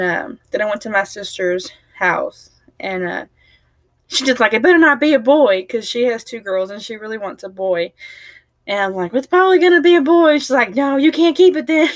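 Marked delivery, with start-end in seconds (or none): 4.5-5.7 high, loud playful warning; a sister half-joking; loud teasing insistence; mock demand. Sister's retort. 9.1-10.4 high, loud, fast tease; loud playful needling; teasing. Retold or imitated. Teasing her sister. 10.8-11.9 high, loud mock refusal; a sister pretending to be outraged; hammed-up joking threat. Sister jokes back.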